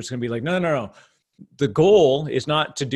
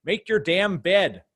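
slow, high, loud stern command; Jordan Peterson impression; 6 semitones higher, 5 dB louder, slower than usual. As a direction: bark it like a stern professor. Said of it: "He's like".

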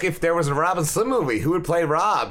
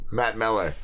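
normal talking voice

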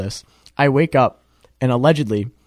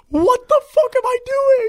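high-pitched, louder outburst of alarm; panicked disbelief; 4 dB louder than usual. Retold or imitated. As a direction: blurt it out high, utterly bewildered by yourself. Waking up in roommate's bed.

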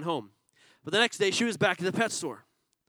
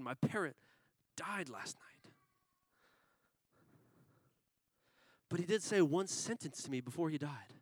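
much softer, sorrowful and subdued; sad; 3 semitones lower, 11 dB quieter than usual. Acted. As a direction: deliver the bad news heavy and quiet. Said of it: "She was sad".

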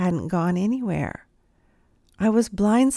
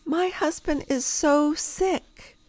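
slow, higher, mildly pleading imitation; lady's confession; 7 semitones higher, slower than usual. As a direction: reveal the bombshell slowly. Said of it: "She said".

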